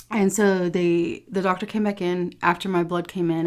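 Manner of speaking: normal talking voice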